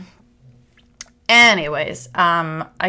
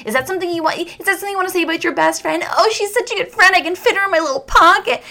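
high, fast, sneering; mock them with rising scorn; mocking indignant kid; 11 semitones higher, 4 dB louder, faster than usual. Eleven-year-old mocking boys' crush, high and snide.

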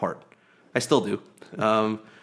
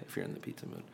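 breathy whispered echo; whispering; 17 dB quieter, much faster than usual. Real, not acted. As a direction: whisper it back to your partner. Echoing partner's line in whisper.

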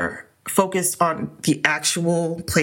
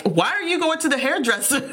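scolding mayor; 8 semitones higher than usual. Retold or imitated. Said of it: "the one Italian mayor that was like".

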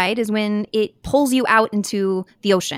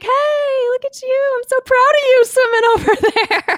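mock cheering call; 4 dB louder than usual. Loved one on shore cheering the swimmer, high calling. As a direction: call out proudly from afar, hammed up.

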